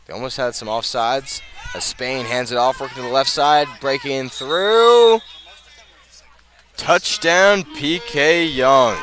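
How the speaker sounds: building to a high, loud, stretched touchdown shout; touchdown call. Real, not acted. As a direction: ride the run all the way into the end zone. Calling a touchdown run.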